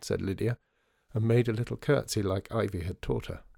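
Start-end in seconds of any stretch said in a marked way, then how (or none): none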